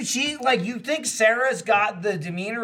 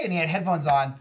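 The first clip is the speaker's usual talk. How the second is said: ordinary talk, nothing marked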